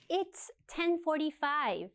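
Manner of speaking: spoken slowly for learners; slow model answer. Shown as a demonstration. Teacher reads the answer slowly for students. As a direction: drill it slowly and clearly. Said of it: "what are the questions for these answers".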